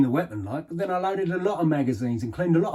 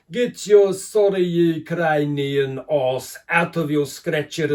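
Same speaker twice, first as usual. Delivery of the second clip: loud, high parade-ground bellow with drawn-out orders; sarky sergeant major; 6 semitones higher, 10 dB louder, slower than usual. Retold or imitated. Ex-soldier aping his South African quartermaster, hammed-up sergeant-major shouting. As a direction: bellow like a sadistic ramrod drill sergeant. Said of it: "British sergeant major cliche, you know, the sarky, sadistic ramrod type".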